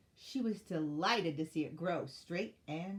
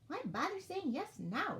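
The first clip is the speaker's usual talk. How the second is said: high, slow penguin voice; dismissive penguin; 10 semitones higher than usual. A narrator's reading. Penguin declining to help the elf, high and dismissive. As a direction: swoop up high, can't be bothered.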